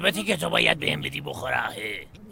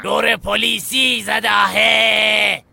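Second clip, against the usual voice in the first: loud, higher, very slow cry; drawn-out shout; 4 semitones higher, 6 dB louder, much slower than usual. Acted. Guide calling out to the kid, drawn-out shout. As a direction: stretch every word out loudly.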